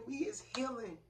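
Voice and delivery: whispered, awed; hushed message. Real, not acted. Passing on spirit's words to viewers in an awed whisper. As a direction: breathe it like a sacred secret.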